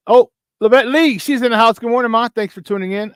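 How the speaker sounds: high, loud sudden greeting; excited shout-out. Real, not acted.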